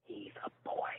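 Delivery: barely-there whisper; hushed confession. A narrator's reading. Wife admitting the truth to her husband, whispered. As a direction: whisper it with almost no voice.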